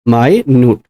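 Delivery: माइन्यूट split into "my... nyoot"; syllable-by-syllable pronunciation. Shown as a demonstration. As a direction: break माइन्यूट into two separate long syllables. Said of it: "इसी को आप माइन्यूट बोलते हो".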